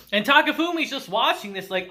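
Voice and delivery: ordinary delivery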